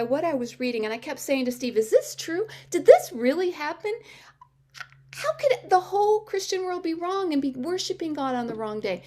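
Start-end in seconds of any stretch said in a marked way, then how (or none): 1.8-9.0 high, incredulous questioning, retold; astounded questions pitched well up; shocked. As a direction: act out disbelief at a discovery. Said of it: "I was in such shock".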